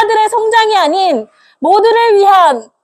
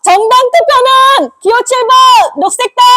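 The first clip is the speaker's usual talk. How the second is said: rally shout; 6 semitones higher than usual. Real, not acted. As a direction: shout it to the crowd. Leads rally chant.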